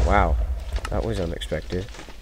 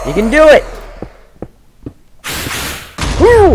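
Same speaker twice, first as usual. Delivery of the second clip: much higher and louder, rooting for him; cheering shout; 7 dB louder than usual. Real, not acted. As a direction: yell encouragement like a fan. Cheering on the boss.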